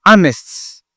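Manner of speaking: Honest, H muet, dit anest; honest sans H. Shown as a demonstration. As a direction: dire Honest sans souffler le H. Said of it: "on prononce pas". (Honest prononcé anest)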